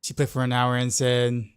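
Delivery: usual speaking voice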